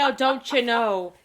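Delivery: exaggerated Minnesotan, played loud; mock Minnesotan accent. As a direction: mimic a broad Minnesotan accent. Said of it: "I don't say".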